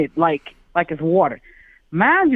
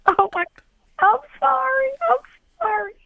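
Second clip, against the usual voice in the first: imitating a drunk sob, pitched way up; mock crying apology. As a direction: whimper sorry through fake tears. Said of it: "and I started to cry".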